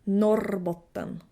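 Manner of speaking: Norrbotten with a long rolled R; northern Swedish trilled R in Norrbotten. Shown as a demonstration. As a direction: roll the R in Norrbotten, slowly. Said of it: "northern thirds of Sweden are rolling".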